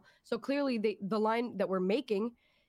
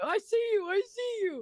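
excited; 10 semitones higher than usual. Retold or imitated. Friends spotting each other.

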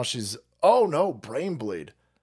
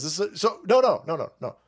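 normal talking voice

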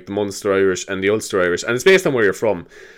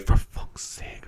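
whispered, very slow silent mouthing; just mouth the words, no voice; 7 dB quieter, much slower than usual. Retold or imitated. Santa on mute, mouthing a curse, whispered slowly. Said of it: "him mouthing".

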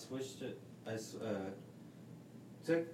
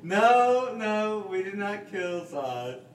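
loud denial; 7 semitones higher, 12 dB louder, slower than usual. Real, not acted. Denies killing fish, loudly.

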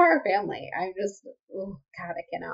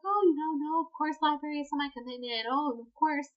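mock placating; 8 semitones higher than usual. Voices Macy placating the parents.

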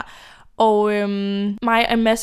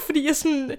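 usual speaking voice

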